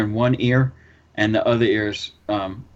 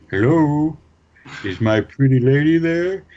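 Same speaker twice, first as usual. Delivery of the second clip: raised, mumbled prank-call voice; boss imitation; 4 semitones higher, 4 dB louder, slower than usual. Prank caller asking for his pretty lady, mumbled boss impersonation. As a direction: impersonate the boss, pitched up and drawn out. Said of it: "do a pretty good imitation of him".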